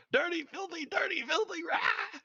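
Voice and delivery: very high shouting shriek; shrieking lady. Acted. GM voicing the lady screaming at the horde of rats, very high shout.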